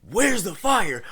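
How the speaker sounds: high, loud, urgent holler; acted-out alarmed yell; screaming. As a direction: shout it as if searching frantically. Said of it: "he's screaming".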